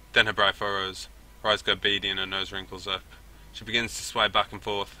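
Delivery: ordinary talk, nothing marked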